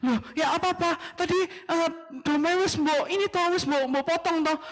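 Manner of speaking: high, nagging Javanese wife; high-pitched nagging wife; scolding wife. Acted. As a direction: needle him about the lamb.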